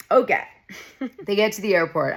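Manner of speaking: ordinary talk, nothing marked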